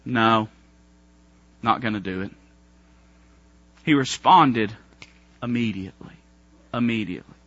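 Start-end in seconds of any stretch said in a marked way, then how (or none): 0.0-2.3 stubborn refusal. Retold or imitated. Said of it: "what we mean about that is".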